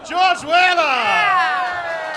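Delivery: host hyping the act to the crowd; announcer call. Real, not acted. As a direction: boom the name out like a ring announcer.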